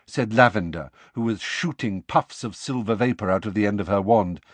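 usual speaking voice

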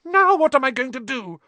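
loud, very high panicky wail; shrill. A narrator's reading. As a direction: demand it shrilly at full pitch. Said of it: "she asked shrilly".

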